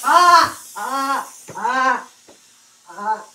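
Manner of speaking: loud, high agonized yelps; hammed-up pain screams. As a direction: scream as the hot tool burns the mouth. Hot instrument put in mouth.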